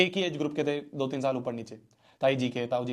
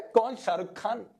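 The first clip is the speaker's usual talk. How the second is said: swaggering boast; 6 semitones higher, slower than usual. Pretend.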